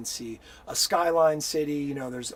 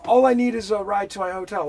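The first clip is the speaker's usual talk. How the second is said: loud indignant snap; 6 semitones higher, 10 dB louder than usual. Retold or imitated. Snapping at armed scammer.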